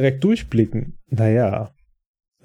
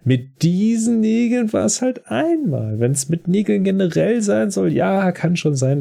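defensive brother; 8 semitones higher than usual. Retold or imitated. Ben defends himself, acted quote. (leicht gespielter Ben, höher und langsam empört)

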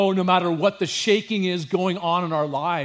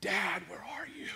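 unvoiced, broken whisper of a forsaken son; anguished whisper; 3 semitones higher, 13 dB quieter than usual. Jesus on the cross whispering to his father, anguished. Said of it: "he's crying that out".

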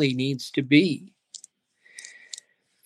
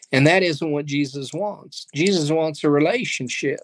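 ordinary delivery

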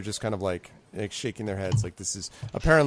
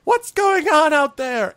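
high, loud, drawn-out call; self-important little goblin shouting from behind; 9 dB louder, slower than usual. Acted. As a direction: holler slowly in a high pompous voice. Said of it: "you hear someone say from behind them".

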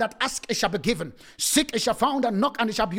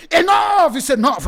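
high, loud preacher's shout; shouted declaration; 4 semitones higher, 7 dB louder than usual. Acted. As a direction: shout it out at the enemy. Warfare prayer, repeated declaration.

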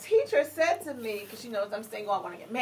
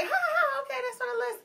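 mock-polite laugh, higher; polite brush-off; 9 semitones higher, 5 dB quieter than usual. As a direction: fake a cheerful yes you don't mean. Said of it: "and so I was like".

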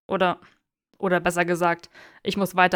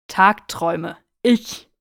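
louder, pained cry of the word; anguished outburst; 10 dB louder, slower than usual. Acted. Draco confessing daydreams to Theo, loud and anguished. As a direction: blurt it out in despair.

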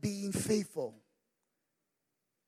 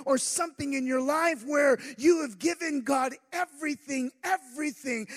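ordinary talk

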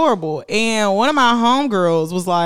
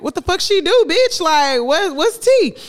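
high eager gossip, pitch way up; nosy excitement; 10 semitones higher, 4 dB louder than usual. Retold or imitated. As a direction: beg for the tea, voice climbing high. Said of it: "I was like".

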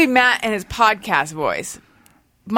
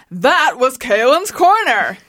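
slow, drawn-out announcer sign-off; hammed-up show announcer; announcery voice; 3 dB louder, slower than usual. Shown as a demonstration. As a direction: stretch it out like a big outro. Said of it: "in an announcery voice".

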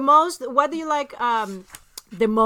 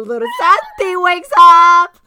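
slow, much louder, higher voice; loud drawn-out call; 6 semitones higher, 13 dB louder, much slower than usual. Real, not acted. Host hurrying before little Santi wakes up, loud. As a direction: blurt it out loudly, stretching the words. Calling over the child waking.

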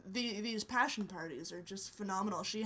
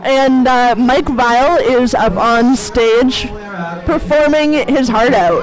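talking over the band; 22 dB louder than usual. Real, not acted. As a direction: shout to be heard over the stage. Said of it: "trying to compete with [name]".

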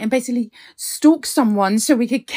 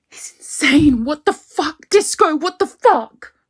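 higher, louder, rising to a shout; panicked outburst; 5 semitones higher, 4 dB louder than usual. Acted. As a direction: yell it at the app in panic. Panicked call to the app.

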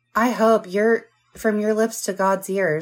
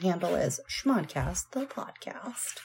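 whispering; 11 dB quieter, slower than usual. Real, not acted. Whispered handle.

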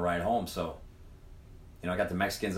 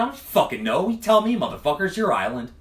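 retorting; 8 semitones higher, 6 dB louder than usual. Retold or imitated. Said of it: "And I said".

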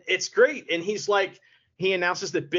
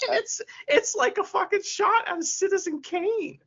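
much higher, gushing delight; excited disbelief; 13 semitones higher than usual. Real, not acted. Host marveling at a cinematic shot in a TV show, excited high disbelief. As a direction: rave about the shot in amazed falsetto.

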